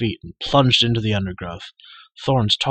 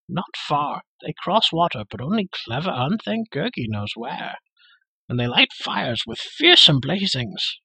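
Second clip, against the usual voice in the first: the creature Gurgi grumbling for food; whiny creature; 6 semitones higher than usual. A narrator's reading. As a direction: complain like a sulky beast.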